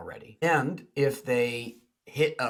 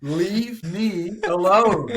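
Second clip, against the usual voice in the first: higher, louder, slow exasperated groan; annoyed brush-off; 7 semitones higher, 7 dB louder, much slower than usual. Retold or imitated. Withdrawn partner pushing the eager one away, loud and fed up. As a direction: drag it out like you're at the end of your rope. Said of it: "they're just like".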